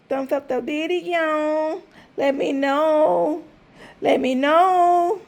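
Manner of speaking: slow, stretched words to viewers; slow drawn-out ask. Real, not acted. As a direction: draw each word out slowly. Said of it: "drawn out request".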